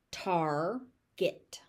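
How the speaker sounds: "Target" split into tar, get with a gap; syllable by syllable. Shown as a demonstration. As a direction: say "Target" slowly in two beats. Teacher breaks word into syllables.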